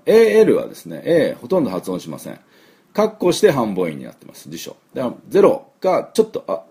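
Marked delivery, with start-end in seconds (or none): none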